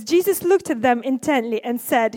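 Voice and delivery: normal talking voice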